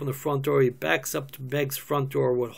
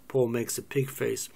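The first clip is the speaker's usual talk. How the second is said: ordinary talk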